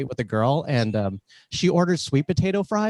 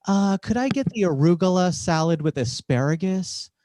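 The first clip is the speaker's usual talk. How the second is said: hemming and hawing with long drawn-out hums; picky diner stretching out each request; entitled customer; 3 semitones higher, slower than usual. Acted. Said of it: "how entitled people used to be".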